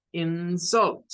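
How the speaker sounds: teacher's demo of "insult" as in-SULT; "insult" with the force on its final syllable; stress on second syllable. Shown as a demonstration. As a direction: hold back IN, then hit SULT in "insult". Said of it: "esta primera la debemos de pronunciar como".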